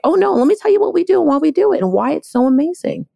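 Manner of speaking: high, fast, eager sales pitch; confident pitch. Pretend. Owner pitching the company to the market, eager and fast. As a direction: rattle it off brightly to the market. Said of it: "your voice is strong enough to say to the market".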